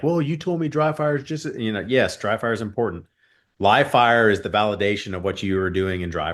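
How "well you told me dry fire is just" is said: lightly imitated newer shooter, pitched higher; a hint of a student's higher-pitched protest; confused newer shooter. Newer shooter questioning the coach's dry fire advice, lightly imitated. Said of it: "they'll say, like".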